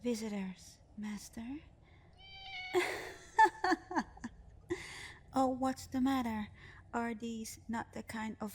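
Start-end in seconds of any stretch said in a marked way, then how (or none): 2.2-5.3 shrieking high delighted laugh; gleeful high laugh. Acted. Genie laughing at her master's surprise. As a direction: burst out laughing at the trick.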